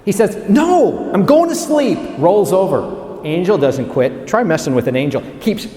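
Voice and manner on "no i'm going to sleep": high, raised protest; petulant refusal. Acted. Elijah refusing the angel who wakes him, acted petulant protest. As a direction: refuse like a grumpy sleeper.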